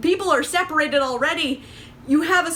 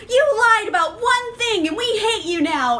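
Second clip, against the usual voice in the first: high and louder sarcastic imitation; mock outrage; 8 semitones higher, 3 dB louder than usual. Reviewer mocking the squad kicking a member out, high louder parody. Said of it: "they're all just like".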